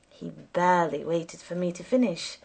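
ordinary delivery, nothing marked

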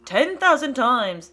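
slow, loud mocking echo of the father. A narrator's reading. George mocking his father's boast, loud and slow. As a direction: throw the words back at him, jeering. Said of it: "to make fun of his father".

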